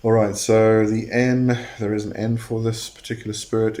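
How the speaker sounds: normal talking voice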